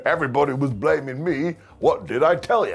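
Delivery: lightly voiced told-you-so wrestler; smug I-told-you-so, lightly voiced; mock Naomi. Naomi gloating to the others, lightly voiced. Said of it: "'cause Naomi went".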